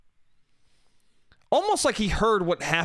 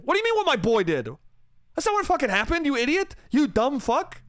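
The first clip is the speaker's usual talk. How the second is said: high-pitched tirade, re-enacted with relish; exasperated insults; 8 semitones higher, faster than usual. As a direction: lay into an idiot, voice climbing. Retold insults at drunk man.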